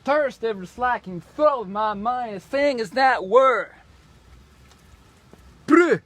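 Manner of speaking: high, loud declaiming of nonsense; garbled backwards reading. Acted. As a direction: belt out gibberish as grand verse. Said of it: "Là, je l'ai lu à l'envers".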